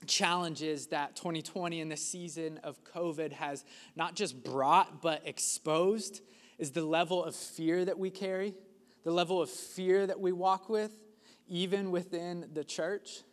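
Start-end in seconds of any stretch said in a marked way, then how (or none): none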